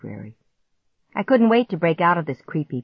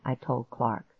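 usual speaking voice

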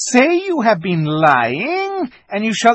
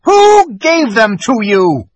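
much higher and louder, slow and hard; icy demand; 11 semitones higher, 11 dB louder, slower than usual. Acted. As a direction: drag each word out menacingly. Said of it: "said the witch".

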